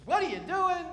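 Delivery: loud, high mock outburst; a lightly voiced Moses, accented. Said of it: "Probably with that accent".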